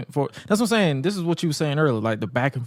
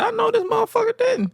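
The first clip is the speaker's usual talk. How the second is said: mock indignant Trish. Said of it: "she said".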